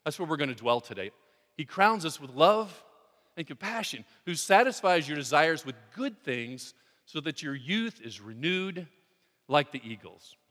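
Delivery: preacher reciting Psalm 103 aloud, fervent and loud; fervent scripture reading. Acted. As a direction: proclaim the verse to the congregation.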